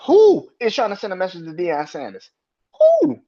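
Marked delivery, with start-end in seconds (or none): 2.8-3.2 "Who?" far higher and louder; mock-outraged high "Who?"; incredulous. Real, not acted. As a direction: shoot "Who?" up in disbelief. Incredulous question.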